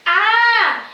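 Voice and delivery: the echo throws back a high, loud "Ah!"; the mountain echo repeats the boy's cry, loud and high; echoed scream. A narrator's reading. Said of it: "he hears a voice repeating".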